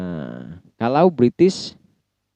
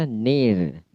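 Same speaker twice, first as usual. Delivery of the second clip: near slow with a strong R; American near, slow, R at the end. Shown as a demonstration. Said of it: "satunya near".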